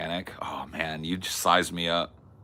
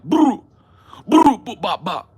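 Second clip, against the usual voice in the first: mock babble; 12 semitones higher, 11 dB louder than usual. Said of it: "She was like".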